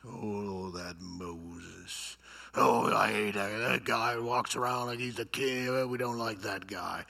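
low grumbling complainer; sour, low grumble behind someone's back. Acted. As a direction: mutter resentfully, like a malcontent in the crowd. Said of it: "grumbled against Moses".

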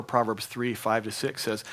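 ordinary delivery

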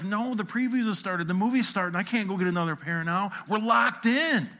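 high, mock-frantic protest; acted flustered refusal; exasperated panic. Panicked protest to wife, freaking out.

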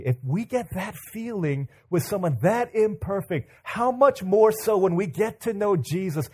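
building to high, loud sermon climax; impassioned preaching. Real, not acted. Preacher comparing a crush to knowing Jesus, impassioned preaching. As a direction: drive the point home with rising fervour.

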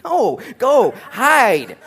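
high, loud urging with a laugh; urgent calling out. Acted. Friends telling Paul to flee, loud mock urging. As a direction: send him off to hide, loudly.

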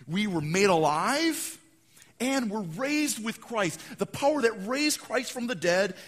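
rising sermon proclamation; preaching at full force. Acted. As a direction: preach it out with power. Preacher building to climax.

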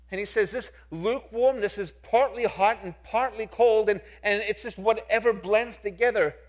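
loud, driving pulpit preaching; preaching at full force. Acted. Pastor preaching loudly to his church about total commitment. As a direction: press every line home to the congregation.

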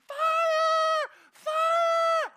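very high, very loud panicked shriek; hysterical screaming. Retold or imitated. Neighbor woman running up the street, screaming in panic. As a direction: scream it at the top of your lungs. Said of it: "at the top of her lungs running up our street screaming".

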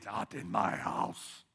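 low, slow mock growl of a basketball star; a hint of a shot-blocker's taunt; Dikembe Mutombo. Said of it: "Remember him wagging his finger?".

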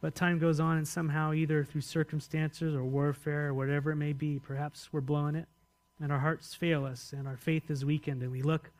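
ordinary talk

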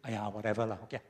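lazy Singlish shrug. Retold or imitated. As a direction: brush it off in Singlish. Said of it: "Do you say".

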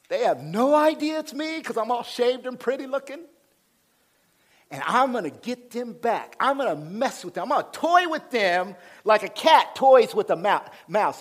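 high, loud, hammed-up relish; gloating schemer. Preacher voicing Joseph toying with his brothers, gloating. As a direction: crow about the revenge to come. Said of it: "What I read was Joseph's going".

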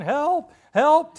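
high, loud yells; yelling for help. Retold or imitated. Tin Man yelling for help, high and loud. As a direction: call out for help. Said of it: "he starts yelling".

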